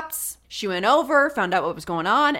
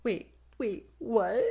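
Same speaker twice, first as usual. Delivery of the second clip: softly faked surprise, badly acted; fake shock; 2 semitones higher, 6 dB quieter, slower than usual. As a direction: play bad innocent shock.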